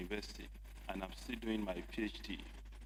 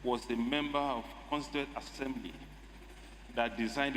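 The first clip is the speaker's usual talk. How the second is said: emphatic oratory; 3 semitones higher, 3 dB louder than usual. Real, not acted. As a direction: proclaim it over the interruption, stretching it out. Resumes speech over Speaker's interruption.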